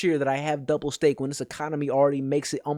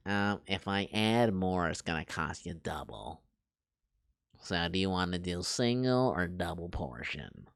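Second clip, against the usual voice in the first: low, soft, deadpan line-worker voice, much quieter; mocking Mike Wazowski; 8 semitones lower, 5 dB quieter than usual. Mocking the Chipotle line worker, low and hushed deadpan. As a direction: mimic a bored cashier droning the upsell. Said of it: "They talk like that one bitch, the frickin' Mike Wazowski".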